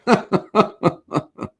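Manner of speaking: high, loud burst of laughter; hearty laugh. Real, not acted. Host laughing after his jibe at the senator, amused laugh. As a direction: laugh out loud in a long run.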